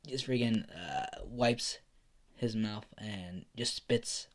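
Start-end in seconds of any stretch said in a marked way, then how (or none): none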